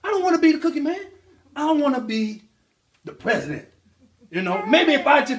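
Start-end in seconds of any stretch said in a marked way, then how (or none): 0.0-3.7 put-on high, excited baby speech; voicing the baby. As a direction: announce big dreams, pitched up. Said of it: "you turned around and you said".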